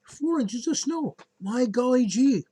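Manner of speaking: high, gee-whiz folksy exclaim; golly-gee wide-eyed wonder; fake wholesome. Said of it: "as radar would say".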